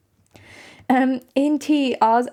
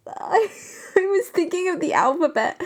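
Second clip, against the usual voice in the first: high, giggly admission; breaking into giggles mid-sentence; laughing through words; 7 semitones higher than usual. Real, not acted. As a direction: laugh it out, embarrassed. Laughing at own mistake.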